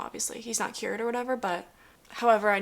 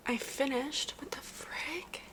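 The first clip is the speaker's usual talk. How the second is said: whisper; 4 semitones higher, 6 dB quieter, much slower than usual. Real, not acted. Disbelief at the book's ending.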